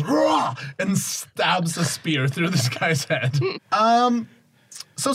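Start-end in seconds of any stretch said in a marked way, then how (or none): 0.0-0.5 short roaring battle cry, pitched way up; dinosaur voice roar. Retold or imitated. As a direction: belt out a quick monster roar. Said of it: "and Gronko just goes".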